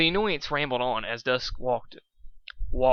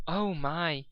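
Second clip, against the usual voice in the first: with concern; 4 semitones higher, 3 dB quieter than usual. Acted. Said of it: "said Fluttershy with concern".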